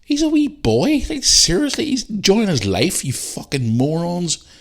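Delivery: high-pitched indignant tirade; real anger at online critics; furious rant. Real, not acted. Furious defence of his son.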